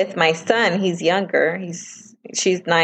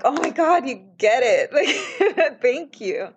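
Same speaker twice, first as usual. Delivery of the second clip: high, squealing, laughing gush of relief; overjoyed gratitude; 6 semitones higher than usual. Retold or imitated. A mom thanks the school parent at trunk or treat, in high, laughing, overjoyed gratitude. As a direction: burst out with delighted thanks, pitch jumping way up into a laugh.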